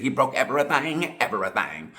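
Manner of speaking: quoting the comedian's line in his voice, with a hint of mimicry; Cat Williams impression. Vlogger quoting Cat Williams, a light comic impression. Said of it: "like Cat Williams said".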